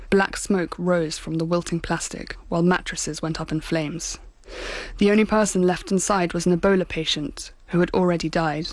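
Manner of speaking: ordinary talk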